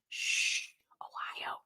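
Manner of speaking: hushed, voiceless echo; shush, then whisper the name tiny; barely audible mock hush; playful whisper. Hushes her own shout.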